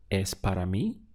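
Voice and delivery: Es para mí? with the pitch rising on mí; rising question. Shown as a demonstration. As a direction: ask it, lifting mí at the end. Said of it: "you just use the intonation of your voice".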